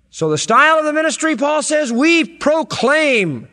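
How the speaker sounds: loud, high, impassioned preaching; soaring revival preacher cry; preaching at full force. Acted. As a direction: build it up like a pulpit climax.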